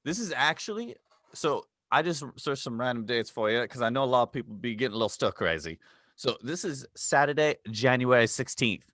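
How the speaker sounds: hammed-up Australian travel host; Aussie accent. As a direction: play the jolly Aussie guide with plenty of mate. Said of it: "with your accent".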